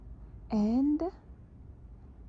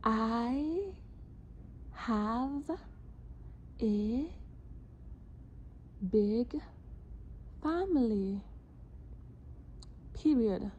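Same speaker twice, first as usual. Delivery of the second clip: one word at a time, clearly; dictating; 5 semitones higher, much slower than usual. Shown as a demonstration. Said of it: "This dictation".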